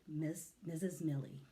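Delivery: ordinary delivery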